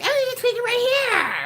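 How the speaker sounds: high, loud mocking echo of her words; mimicking tweaker. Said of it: "the fool starts mimicking me".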